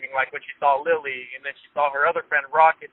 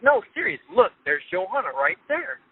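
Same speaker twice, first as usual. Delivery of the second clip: high insistent urging; 6 semitones higher than usual. Retold or imitated. Insisting while laughing to fiancée.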